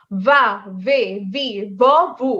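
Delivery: "va, ve, vi, vo, vu" with a true v; V pronunciation. Shown as a demonstration. Teacher modelling V for Bangladeshi learners, pronunciation demo. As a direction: buzz the v in each syllable, no b. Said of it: "আমি কিন্তু ভ পড়ছি".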